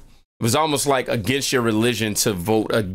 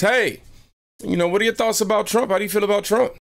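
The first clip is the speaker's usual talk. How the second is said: voiced driver question; 11 semitones higher than usual. Driver asking, voiced high.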